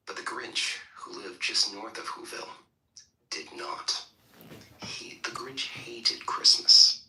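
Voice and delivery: hushed storybook narration; whispery read-aloud narration; 1 story reading. Acted. Reads Grinch story.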